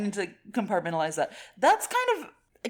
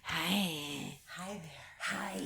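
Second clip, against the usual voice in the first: very soft, breathy ASMR greeting; 4 semitones lower, 9 dB quieter, much slower than usual. Acted. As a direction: whisper it close to the mic. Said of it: "Doing that A S M R voice".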